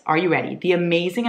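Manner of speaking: ordinary delivery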